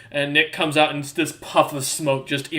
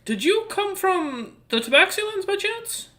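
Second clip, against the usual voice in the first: Galsair. Acted. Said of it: "She kinda nods and goes".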